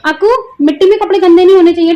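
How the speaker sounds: high, loud, quick caution to a little one; mum's loud, high-pitched reminder. Real, not acted. Mother cautioning her small child.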